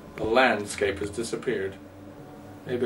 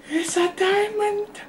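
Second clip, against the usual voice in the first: very high slow squeal of joy; thrilled shriek at finding a diamond; excited squeal; slower than usual. Real, not acted. Finds a diamond.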